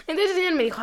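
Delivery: normal talking voice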